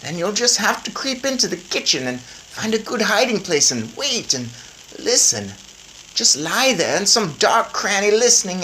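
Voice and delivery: high, brisk grandmother voice. A narrator's reading. Grandmother planning with her grandson, high brisk voice. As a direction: plan it out like a determined granny.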